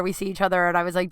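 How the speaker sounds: ordinary talk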